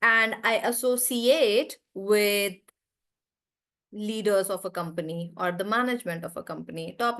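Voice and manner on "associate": "associate" as a verb, long final "ATE"; "associate" ending in a full "AYT"; stress on ATE. Shown as a demonstration. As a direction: slowly stretch the end of "associate". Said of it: "I will stress on C eight".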